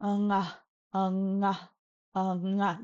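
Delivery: "unga" with a little uh leading into a held ng; practice-drill "unga", uh then nga; uh before NG. Shown as a demonstration. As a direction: say "unga", lingering on the NG. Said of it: "put a little bit of an uh".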